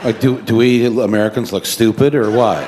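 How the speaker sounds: mock the claim like a disbelieving guard; scoffing officer. Airport officer scoffing at the farmer's Oscar claim, loud and incredulous.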